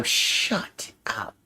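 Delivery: whispered shut up. Real, not acted. Embarrassed at deity joke.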